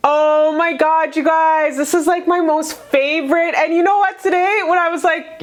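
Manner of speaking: excited, high-pitched gushing; thrilled. Real, not acted. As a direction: squeal it like your favourite card just came up. Thrilled at favourite card.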